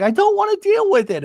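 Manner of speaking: very high mock whine; retold whiny refusal. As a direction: imitate a friend in a huff. Acted refusal.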